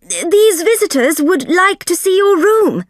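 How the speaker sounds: high, loud call through the door; shouting. Acted. Soames shouts to McLaren behind the door, loud. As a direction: raise the voice so he hears behind the door. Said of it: "Mister Soames shouted".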